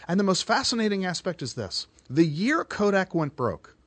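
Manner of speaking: ordinary talk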